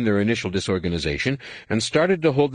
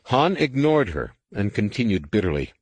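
ordinary delivery

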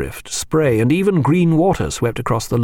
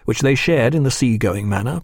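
ordinary delivery, nothing marked

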